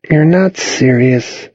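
high, slow drawn-out protest. A narrator's reading. Girl on the phone to boy outside, protesting. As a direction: object in disbelief, stretching the words. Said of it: "she protested".

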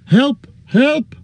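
high, shrill calls for help; lost hobbit calling into the forest; crying for help. A narrator's reading. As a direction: call out desperately, pitched high.

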